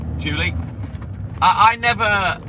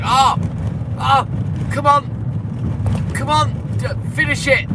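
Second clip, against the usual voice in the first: high, breathy imitation of an impatient listener; mimicking Valerie urging him to finish; impatient mock plea; 7 semitones higher, slower than usual. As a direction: beg him to get on with it. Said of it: "to keep Valerie thinking".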